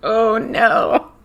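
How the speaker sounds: words squeezed through laughter; laughing while talking. Real, not acted. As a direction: crack up mid-sentence. Laughing at a crude joke.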